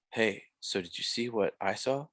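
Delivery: soft, hushed imitation of a movie character; mock whisper. Imitating Commissioner Gordon talking to Batman, mock whisper. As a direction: half-whisper it, imitating an overly quiet film cop. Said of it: "Why are you whispering?".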